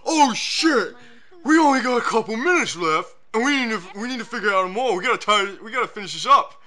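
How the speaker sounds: high frantic panicking voice; mock panic, loud and high; panicked writers. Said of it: "the writers just went".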